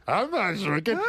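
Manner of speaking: put-on lightly slurred drunken denial; mock-drunk protest, put on lightly; imitated drunk. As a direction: do a tipsy man protesting. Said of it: "you could see the guy's drunk".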